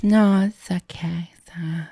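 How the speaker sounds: faint breathy whisper, voice almost gone; tiny whisper. Retold or imitated. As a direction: whisper it shyly, barely audible. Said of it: "that's what she sounded like".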